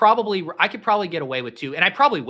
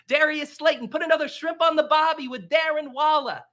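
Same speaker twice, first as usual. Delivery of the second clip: high, loud cartoon Aussie, stretched out; cartoonish Australian accent; 10 semitones higher, 3 dB louder than usual. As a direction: shout the roster like a cartoon Australian. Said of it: "I'm like a cartoon".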